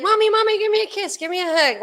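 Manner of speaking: put-on high, pleading little-child voice; imitating little kids. Gina imitates her young kids begging for hugs, high and pleading. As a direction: mimic a small child begging mommy.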